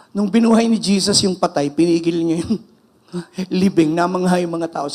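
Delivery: usual speaking voice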